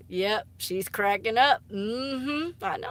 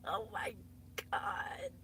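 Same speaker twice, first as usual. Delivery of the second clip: 1 whispered god; 16 dB quieter, slower than usual. Real, not acted. Embarrassed whisper.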